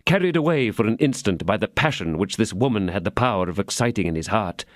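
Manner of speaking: ordinary delivery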